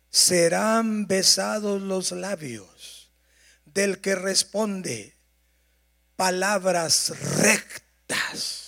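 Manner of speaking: slow, deliberate reading aloud; unhurried verse read in unison; slow scripture reading. Real, not acted. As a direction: read it slowly so the congregation can follow. Congregation reading verse aloud together.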